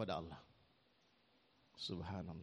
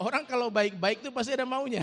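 laughing; 7 semitones higher, 8 dB louder than usual. Real, not acted.

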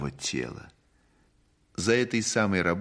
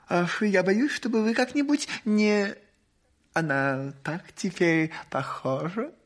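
anxious high lady voice; 10 semitones higher than usual. Acted. Ladies fret about changes.

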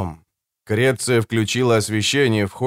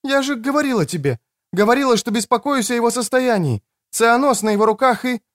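high-pitched woman's protest; defensive woman; 11 semitones higher than usual. Acted. Woman defends loosening the ropes, high protest. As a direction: justify yourself high until cut off. Креция defends herself until cut off.

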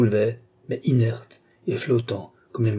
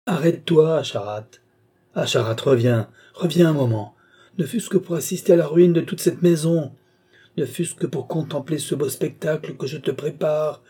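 raised, urgent shout; desperate calling back; 6 semitones higher, 4 dB louder than usual. A narrator's reading. Old man orders Asharat to return, desperate calling. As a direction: call him back before he is gone.

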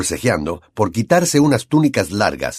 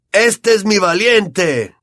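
exclaiming; 9 semitones higher, much slower than usual. A narrator's reading. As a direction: bellow it with pride. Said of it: "exclamaba Wood".

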